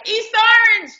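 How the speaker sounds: high excited call of East Orange; excited shout. Retold or imitated. Crowd greets her excitedly by hometown. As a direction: yell it like fans recognizing her hometown.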